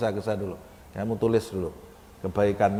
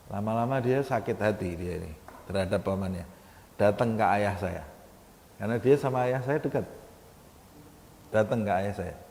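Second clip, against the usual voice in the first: ordinary talk, nothing marked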